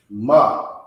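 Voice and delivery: Ma said short and open, one syllable; clipped demo of Ma, no trailing ha; teacher's model Ma; Hebrew ma. Shown as a demonstration. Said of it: "the pronunciation in the Hebrew for that is ma".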